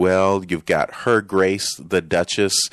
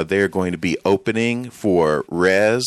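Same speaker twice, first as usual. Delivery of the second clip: ordinary talk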